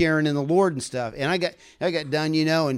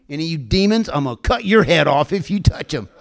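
fierce warning to demons; 3 semitones higher, 5 dB louder than usual. Real, not acted. Preacher threatening demons.

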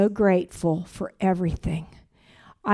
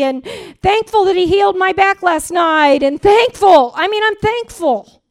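higher, louder, building testimony; preaching; 8 semitones higher, 6 dB louder than usual. Acted. A woman testifying to her church about gratitude, building to a loud fervent cry. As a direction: preach it with rising fervor.

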